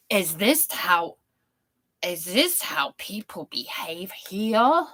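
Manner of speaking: slow, half-whispered bad Brooklyn accent; a clumsy Italian American New Yorker, slowly. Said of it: "So that's a really bad accent".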